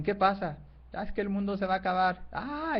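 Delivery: voces agudas de liebres alarmadas; pánico actuado. Acted. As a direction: pregunta y responde con alarma teatral, en tono alto. (liebres que huyen del fin del mundo, pánico actuado)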